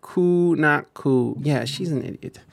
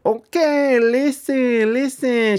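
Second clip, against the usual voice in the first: higher, mocking Puerto Rican New York accent; mock Rosie Perez New York accent; 10 semitones higher, much slower than usual. Streamer mocks the politician's put-on New York Puerto Rican accent. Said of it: "She does the whole, like, Rosie Perez".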